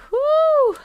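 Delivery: a high ghostly woo, rising and falling; spooky high woo. Acted. Reading the creepy story title to viewers, a ghostly woo. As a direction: ghost noise to tease the chat.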